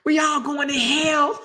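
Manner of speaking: a hint of an outraged colonel; lightly voiced angry colonel; mimicking colonel. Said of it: "This one colonel said".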